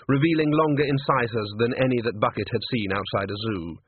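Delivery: ordinary delivery, nothing marked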